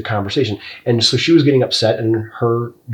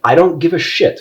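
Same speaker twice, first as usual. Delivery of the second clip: normal talking voice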